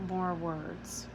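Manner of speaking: quiet, sullen mutter. Acted. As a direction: mumble it into the pillow. Turning away, sullen.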